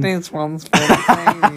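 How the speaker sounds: slow, mocking high sing-song through a laugh; laughing mock repeat of a friend; mocking imitation. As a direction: parrot a friend to tease them. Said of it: "It's spontaneous".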